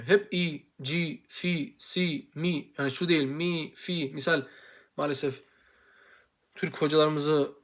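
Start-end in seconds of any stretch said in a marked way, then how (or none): 0.0-4.1 "ci, fi, si, mi" con labios quietos; kasra drills. Shown as a demonstration. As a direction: recita sílabas con i sin mover labios. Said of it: "dudak hiç oynamıyor".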